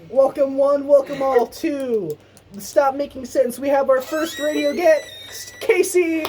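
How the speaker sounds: hosting voice, welcoming the first guest; radio announcer. Acted. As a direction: present the show like an emcee. Host announcing the show on air.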